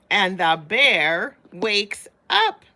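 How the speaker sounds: dramatic. A narrator's reading. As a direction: build to the big moment. Dramatic climax of the story.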